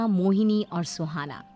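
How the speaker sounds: ordinary delivery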